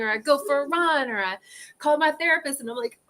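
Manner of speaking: usual speaking voice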